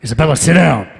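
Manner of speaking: loud, stern hero-dad order; Adam Jensen. Retold or imitated. Dad ordering his kid to sit, loud hero voice. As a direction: command it loudly in the game-hero voice. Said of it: "why are you talking like Jensen?".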